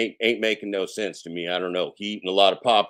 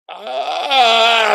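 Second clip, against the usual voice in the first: a sudden raised shout; angry old man yell; 11 semitones higher, 10 dB louder than usual. Pretend. A cranky old man shouting at kids.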